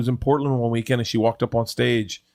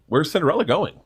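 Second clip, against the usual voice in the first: put-on higher-pitched child's question; a hint of a puzzled kid; lightly voiced little girl; 7 semitones higher than usual. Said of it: "And they go".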